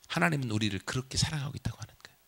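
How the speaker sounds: fast whispered aside. Real, not acted. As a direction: whisper the key truth quickly. Preacher's hushed key point. (빠르게 속삭이듯 말하기)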